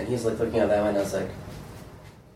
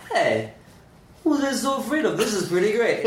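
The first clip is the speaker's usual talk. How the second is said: pitched way up, cheery and chipper; mock upbeat self-talk; 10 semitones higher than usual. To himself, a chipper, pitched-up pep talk. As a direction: sing it out like a cheerful coach.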